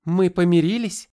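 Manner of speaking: high, loud hopeful question. A narrator's reading. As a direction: call out the question eagerly. Kari asks loudly.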